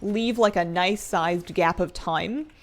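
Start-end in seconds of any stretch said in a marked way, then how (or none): none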